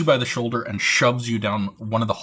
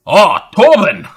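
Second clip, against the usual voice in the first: much higher, loud hearty greeting; booming cook; 10 semitones higher, 13 dB louder than usual. Acted. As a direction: call out to the lad like a bluff cook. Burly cook greets the servant.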